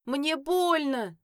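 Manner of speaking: slow, high, loud drawn-out wail. A narrator's reading. Son wailing at his mother about the pain, slow loud wail. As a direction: stretch the words into a whiny howl. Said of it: "завыл сын".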